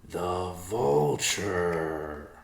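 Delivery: very slow, dawning realisation; slow epiphany. Acted. Riverpaw realising the answer, slow epiphany. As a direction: draw out The vulture as it dawns on you. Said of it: "said in slow epiphany".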